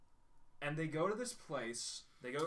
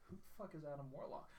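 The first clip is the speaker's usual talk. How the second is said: quick, hushed mock confusion; retold puzzled question, tossed off softly; baffled aside; 12 dB quieter, faster than usual.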